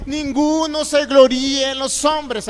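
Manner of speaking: "Ninguno se gloríe en los hombres" slow and loud, every word weighted; forceful proclamation. Real, not acted. Preacher warning the boastful church, slow and loud. Verse proclaimed, then repeated softly.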